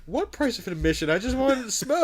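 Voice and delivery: loud, high mock protest; hammed-up confused bystander, rising to a yelp; bewildered victim. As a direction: protest like you grabbed the wrong guy. Acts out mistaken kidnap victim.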